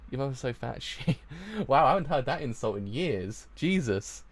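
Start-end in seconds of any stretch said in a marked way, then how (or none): 1.7-4.2 louder, incredulous outburst; amused disbelief. Real, not acted. Reacts to an old insult.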